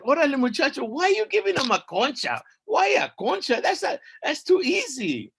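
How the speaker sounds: very high, loud scolding wife; a shrieking-high goddess nagging her husband; very high indignant wife voice; mistress of the dead. Acted. Mictlán Sihuat scolds husband.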